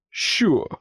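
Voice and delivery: sharp loud bark; the boot barks at the pig. A narrator's reading. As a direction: snap it out like a guard dog. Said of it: "гаркнув дробарка".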